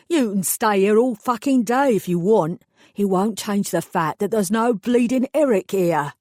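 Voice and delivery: a burly East End man losing his temper. Acted. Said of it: "His voice rose further".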